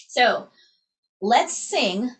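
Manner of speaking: ordinary delivery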